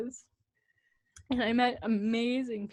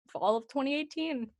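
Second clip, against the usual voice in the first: ordinary delivery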